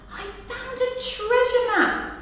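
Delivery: cried with a smile. Acted. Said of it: "cried Percy with a smile".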